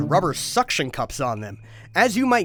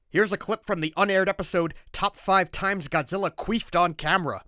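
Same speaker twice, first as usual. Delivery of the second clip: ordinary talk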